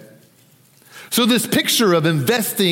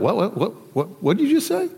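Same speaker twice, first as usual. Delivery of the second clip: higher, incredulous double-take, imitating the room; bewildered reaction; 5 semitones higher than usual. Disciples reacting to what Jesus just said, acted bewilderment.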